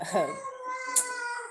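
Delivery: high, teary burst of laughter; helpless laugh. Real, not acted. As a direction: laugh you can't hold back. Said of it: "I don't wanna laugh, but I'm laughing".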